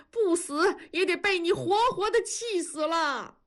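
a grieving elder's reproachful outcry; crying and shouting. A narrator's reading. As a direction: wail at him, half crying. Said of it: "大壮开始哭喊".

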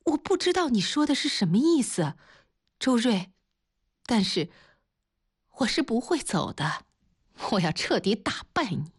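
somewhat high, emotional refusal, broken by pauses; upset defiance. A narrator's reading. Luo Jia refuses Zhou Rui's plea, upset and defiant. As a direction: refuse him through mild distress.

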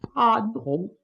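ordinary delivery

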